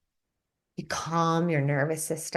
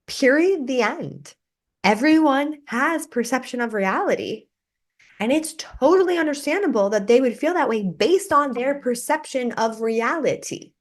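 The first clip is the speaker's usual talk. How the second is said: much higher, louder, insistent close; emphatic; 8 semitones higher, 4 dB louder than usual. Real, not acted. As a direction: hammer the point home. Emphatic wrap-up.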